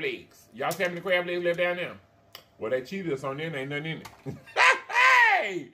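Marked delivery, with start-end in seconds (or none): 4.1-5.3 high laugh. Real, not acted. Cackles after cheated joke.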